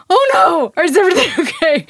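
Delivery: high, loud, fast nervous question with a laugh; panicked. Retold or imitated. Wife asking husband whether she is cut, high panicked laughing question. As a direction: blurt it out half laughing, half terrified.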